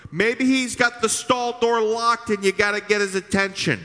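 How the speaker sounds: mocking heckle. Preacher voicing Elijah ribbing the prophets, high mockery. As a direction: tease them like a rival fan. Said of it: "my favorite line".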